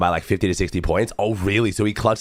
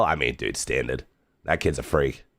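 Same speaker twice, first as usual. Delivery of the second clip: low, dry matter-of-fact; unimpressed deadpan aside; 6 semitones lower, 4 dB quieter than usual. Real, not acted. Dry comment on winner.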